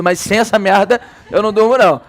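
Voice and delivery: ordinary talk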